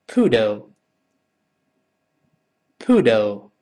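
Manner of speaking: ordinary delivery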